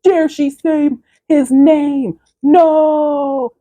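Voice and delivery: high, slow, drawn-out mock cry; outraged demon lord. Demon falling from the sky at the name, hammed-up cry. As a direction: wail it out as the defeated demon. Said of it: "he was like".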